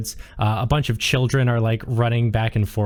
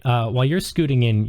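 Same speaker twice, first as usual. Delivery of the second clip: ordinary delivery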